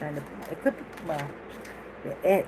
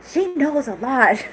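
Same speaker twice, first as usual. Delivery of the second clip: quoting her friend; 7 semitones higher, 5 dB louder, slower than usual. Retold or imitated. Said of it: "my friend who I was with was like".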